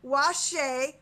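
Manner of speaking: normal talking voice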